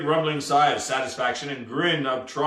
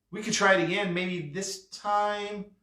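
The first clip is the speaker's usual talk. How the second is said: put-on soft, higher-pitched hesitant boy; tentative suggestion; 5 semitones higher, 5 dB quieter than usual. Boy offering the sorry captain another try, softly tentative.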